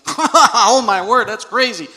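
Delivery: loud, high burst of mock laughter; laughing out loud. Preacher acts out what Sarah didn't do, laughing out loud. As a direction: cackle it out in exaggerated disbelief. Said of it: "She didn't laugh out loud".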